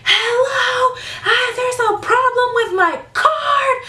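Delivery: hammed-up imitation of a customer banging on the glass; shrill mock customer. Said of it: "she was like".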